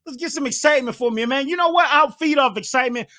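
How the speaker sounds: high, loud and fast, hyped up; bursting with real excitement. Real, not acted. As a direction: pump yourself up as you say it. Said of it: "I'm excited".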